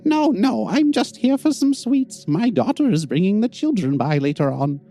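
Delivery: put-on high old woman voice; old lady voice. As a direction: politely decline like a grandmother.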